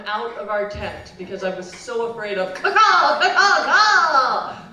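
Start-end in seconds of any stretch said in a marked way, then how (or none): 2.7-4.6 very loud, high crow shriek; screeching bird caw. Acted. Child recalling the bird that scared her, screeching. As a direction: burst into the monster bird's screech. Teller repeats the bird's screech.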